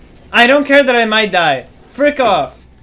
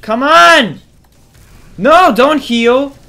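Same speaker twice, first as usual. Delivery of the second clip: frustrated yelling; 6 semitones higher, 8 dB louder, much slower than usual. Real, not acted. Enemy healing mid-fight.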